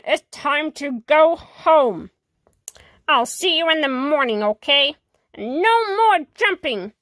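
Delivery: plain reading voice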